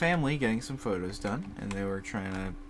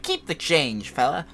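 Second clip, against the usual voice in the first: raised, louder mock big-shot voice; put-on voice; 6 semitones higher, 6 dB louder than usual. Teen customer to cashier over small change, in a put-on voice. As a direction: imitate a customer putting on a swaggering voice. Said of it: "put on, like, a voice".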